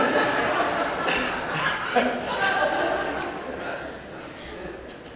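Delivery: airy laugh at his own joke; breathy laugh. Real, not acted. Pastor laughing at his own baby-feeding joke, airy laugh. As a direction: let out a breathy giggle.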